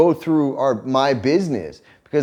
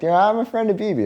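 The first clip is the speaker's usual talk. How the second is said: mocking; 7 semitones higher, faster than usual.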